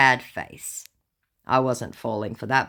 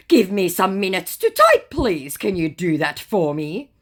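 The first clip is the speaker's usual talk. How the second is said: scammer voice; 6 semitones higher, 6 dB louder than usual. Retold or imitated. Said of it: "scammer's reply quoted".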